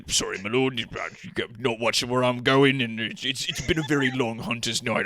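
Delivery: mumbled, stumbling apology; acted flustered, deferential stranger; humbled grumbling. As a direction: grumble to yourself while apologizing to a lord. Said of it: "humbles and grumbles to himself".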